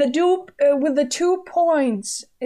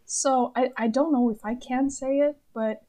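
normal talking voice

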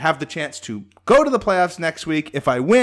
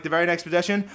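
usual speaking voice

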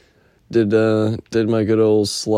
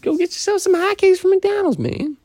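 much higher, put-on squeaky pitch; silly high voice. Urging viewers to get McDonald's hotcakes, in a silly high voice. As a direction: pitch way up like a goofy cartoon.

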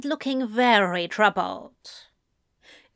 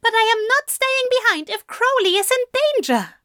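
high, louder, fast protest; insistent protest; 14 semitones higher, 4 dB louder, faster than usual. A narrator's reading. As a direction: refuse flatly, agitated. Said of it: "Aziraphale argued".

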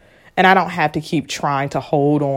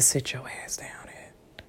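hushed unvoiced question; whispering; 7 semitones lower, 17 dB quieter than usual. Shown as a demonstration. Asking listeners directly, in a whisper. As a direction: ask it under your breath. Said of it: "Yes, I'm whispering".

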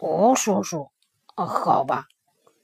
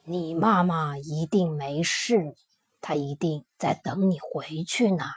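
tender; 7 semitones lower, 7 dB quieter than usual. A narrator's reading. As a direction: gently reassure the little one. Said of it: "霸王龙温柔地说". (低沉、轻柔、缓慢地安慰)

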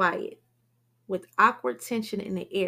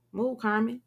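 ordinary delivery

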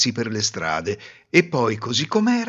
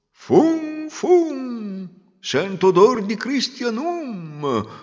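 high, slow sniffing chant; sniffing ogre-like wind; 11 semitones higher, much slower than usual. Acted. The wind sniffing out the hidden girl, slow and eerie. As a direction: sniff the air like a hungry monster.

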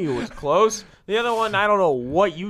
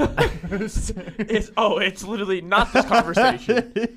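laughing through the words; 4 semitones higher than usual. Real, not acted. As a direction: protest while giggling. Laughing while protesting.

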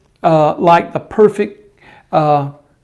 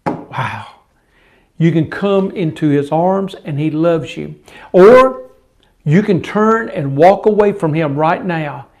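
ordinary talk, nothing marked

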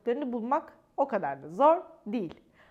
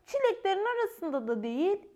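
a small creature puzzling aloud, pitched up; little squirrel voice; 7 semitones higher than usual. Acted. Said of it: "dedi küçük sincap".

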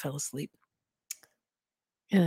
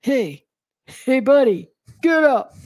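loud, higher-pitched gruff cop shouting; gruff New York shout; 6 semitones higher, 9 dB louder, slower than usual. A narrator's reading. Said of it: "shouted at in a gruff voice".

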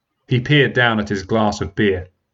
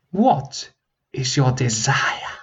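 slippery dragon; 3 semitones higher, slower than usual. Acted. Dragon slyly asking the prince his wish, slow. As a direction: draw the question out slyly.

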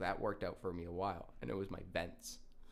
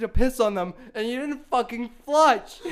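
loud, high, laughing mock fury; hammed-up angry lecture, breaking into laughter; retold dad rant; 17 dB louder than usual. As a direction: rant like a dad who can't believe it. Dad's rant continues.

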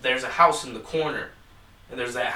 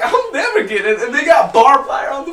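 panicked; 9 semitones higher, 8 dB louder than usual. Retold or imitated. Retold panic in the factory.

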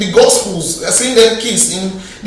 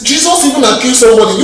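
usual speaking voice

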